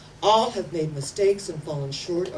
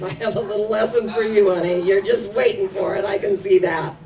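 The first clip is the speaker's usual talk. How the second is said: voice of the universe; 9 dB louder than usual. Acted. Universe teasing us with lessons.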